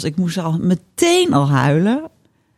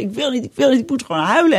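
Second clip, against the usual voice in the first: higher, insistent mock refusal; retold protest; 6 semitones higher, faster than usual. Woman retelling her protest to the coach, pleading.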